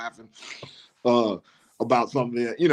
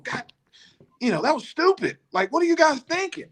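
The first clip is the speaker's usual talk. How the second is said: mock coach rant, voice high; high-pitched upset coach. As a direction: squeak it out like a big upset coach. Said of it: "his voice gets high".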